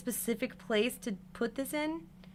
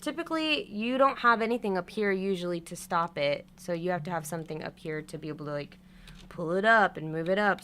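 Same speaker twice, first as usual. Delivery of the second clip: ordinary delivery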